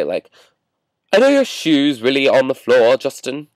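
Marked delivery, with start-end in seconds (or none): 1.1-3.5 snarky, badly faked British accent; horrible British accent. Piers Morgan telling vlogger to clean his room, snarky fake British. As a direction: put on a hammy British scolding. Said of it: "that was my horrible British accent".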